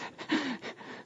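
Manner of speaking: quick airy laugh under the breath; short laugh. Real, not acted. Laughs at son's boast.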